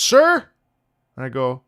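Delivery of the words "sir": high, loud, questioning call; stranger calling out. Retold or imitated. Store worker calls after a customer who is leaving, loud call. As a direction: call out loudly to someone walking off. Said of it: "he goes".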